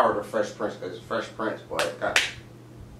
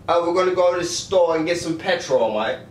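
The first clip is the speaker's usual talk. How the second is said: British attempt that sounds Australian; pitched-up mock British, veering Australian; attempted British accent; 4 semitones higher than usual. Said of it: "That still sound Australian".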